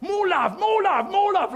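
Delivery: high, loud preacher impression; J John. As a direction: belt it out like a rousing evangelist. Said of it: "He speaks like this".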